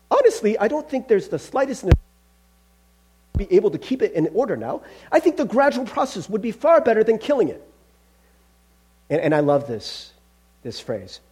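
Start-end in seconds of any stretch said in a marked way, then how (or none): 0.1-7.6 higher-pitched, reasoning ghost voice; evasive ghost. Acted. Ghost arguing for the gradual process, evasive. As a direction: argue nervously for a gentler way.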